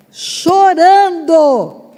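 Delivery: chorando stretched very slowly and sung out loud; preacher's drawn-out chorando. Shown as a demonstration. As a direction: lean long on every syllable of chorando. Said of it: "Diga, chorando".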